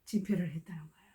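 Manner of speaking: hushed secret reveal; confiding whisper. Real, not acted. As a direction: whisper the surprising truth. Revealing the meeting's purpose.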